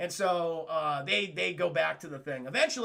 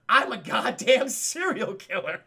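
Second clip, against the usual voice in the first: mock villain reveal; 6 dB louder, slower than usual.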